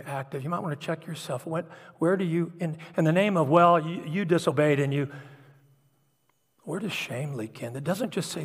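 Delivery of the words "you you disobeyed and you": usual speaking voice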